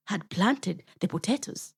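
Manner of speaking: plain reading voice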